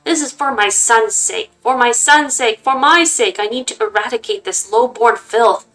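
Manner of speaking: a madman sure fire will cleanse; mad fanatic. Acted. Grandfather silencing mother before burning her, manic fury.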